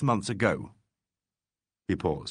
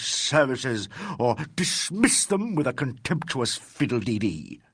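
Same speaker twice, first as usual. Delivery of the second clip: disgraced financier lecturing the detective with venom; venomous tirade; 3 semitones higher than usual. Acted. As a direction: look down on him and spit contempt. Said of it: "delivered with such venom".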